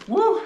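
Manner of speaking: sharp, high, loud woo from the burn. Real, not acted. Mouth burning from the Blue Heat chip, loud high woo. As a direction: yelp as the heat hits.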